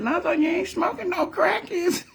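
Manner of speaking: squeaky granny voice asking; grandma checking on Lonzo, high voice; imitating grandma. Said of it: "Then she said".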